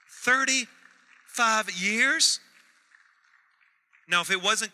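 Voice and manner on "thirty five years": slow, drawn-out, high and loud disbelief; amazed echo. Real, not acted. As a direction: stretch it out in pure astonishment. Pastor echoing in amazement.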